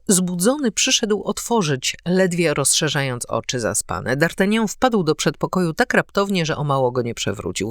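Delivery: plain reading voice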